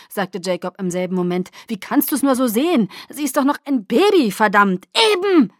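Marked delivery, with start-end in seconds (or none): 1.7-4.9 loud, high-pitched outburst; exasperated, loud protest; angry protest. A narrator's reading. Heated argument over the baby. 5.1-5.5 high, loud one-word shout; sharp yelled comeback; shouted retort. A narrator's reading. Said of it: "schrie ich".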